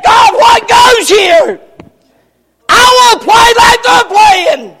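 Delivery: high, slow pleading shout; loud complaint to God. Pretend. Weary plower crying out to God in a loud, slow plea. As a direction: cry out to God, high and drawn out.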